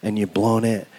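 ordinary delivery

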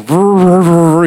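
loud stuck stammering "vrr"; Fonzie. Retold or imitated. Preacher imitating a sitcom character unable to admit fault, stuck stammering. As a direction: mimic choking on a word that won't come out. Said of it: "he can't even get it out".

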